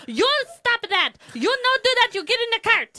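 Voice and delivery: high, loud broken-English mock Chinese accent. Mimicking a friend scolding her kids in a mock Chinese accent. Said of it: "yelling at her daughters".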